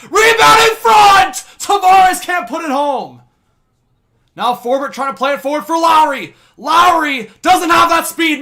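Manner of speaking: high, loud, slow-drawn call of the rebound; excited play-by-play shout. Real, not acted. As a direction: call it like a live goal chance.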